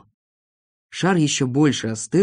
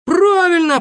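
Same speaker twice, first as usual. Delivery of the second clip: very high, loud exclamation; sudden high burst of agreement; eager agreement; 6 dB louder than usual. Acted. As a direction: chime in with keen approval. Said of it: "подхватил Сиропчик".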